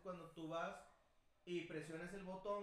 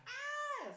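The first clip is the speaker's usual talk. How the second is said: mock scream; 5 dB louder than usual. Lady screaming in stuck elevator.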